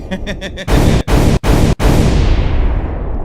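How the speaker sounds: loud, breathy burst of laughter; loud cackle. Real, not acted. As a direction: crack up at your own punchline. Cracks up at her own joke.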